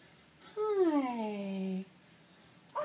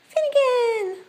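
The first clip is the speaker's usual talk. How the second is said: calling out; 13 semitones higher, 7 dB louder, slower than usual. Real, not acted. Calling the cat.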